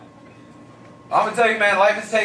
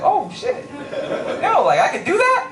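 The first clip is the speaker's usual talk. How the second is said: much higher, laughing disbelief; amazed. Retold or imitated. To the shelter worker, amazed high-pitched disbelief. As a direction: squeak it out in shocked delight.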